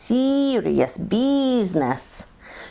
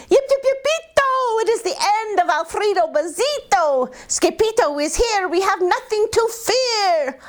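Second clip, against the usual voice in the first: excited cheer; 13 semitones higher than usual. Acted. Don Diego's joyful cheer.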